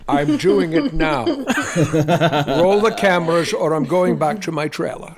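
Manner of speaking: slow, slurred Scottish brogue, laughing through it; hammed-up Scottish diva actor refusing direction; Sean, indignant star. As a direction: drag out the words like an offended Scottish film star. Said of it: "Can you do a Russian accent?".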